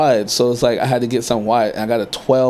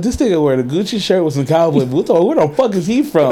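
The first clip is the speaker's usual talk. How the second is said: high, louder mock disbelief; mock-incredulous onlookers; 5 semitones higher, 3 dB louder than usual. New Yorkers gawking at a stranger's cowboy boots, mock-incredulous. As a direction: imitate gawkers, pitch climbing higher as they go. Mimics onlookers staring at his outfit.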